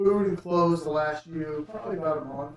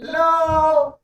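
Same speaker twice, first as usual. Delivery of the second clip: put-on high, loud kid's hello; shouted greeting; 11 semitones higher, 10 dB louder than usual. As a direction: call out like an eager little boy. GM voices boys knocking on tank.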